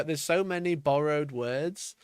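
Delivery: ordinary delivery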